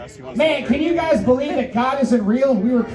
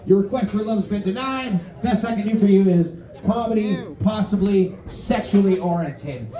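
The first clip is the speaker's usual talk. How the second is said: normal talking voice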